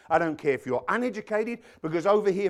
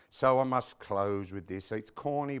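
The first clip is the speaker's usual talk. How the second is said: ordinary talk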